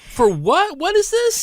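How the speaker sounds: high, hammed-up disbelief; pretending he never heard of the conference, squeaky; mock surprise. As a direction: play dumb, squeaking in shock.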